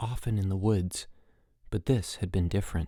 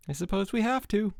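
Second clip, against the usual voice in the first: high, reluctant, mildly sad; 11 semitones higher than usual. A narrator's reading. Mike must rescue Harold, sadly. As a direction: give in with mild sorrow. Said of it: "said Mike sadly".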